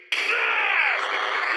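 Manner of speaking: high startled yelp as the lights go out; sharp high gasp of alarm; startled cry. Acted. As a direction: jump at the sudden dark. Lights go out suddenly.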